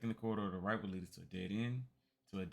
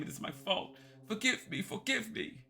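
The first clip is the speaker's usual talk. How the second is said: high, breaking plea for forgiveness; sobbing; 13 semitones higher, 4 dB louder than usual. Acted. Old man begging forgiveness while sobbing. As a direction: weep out the begging. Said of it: "began to sob once more".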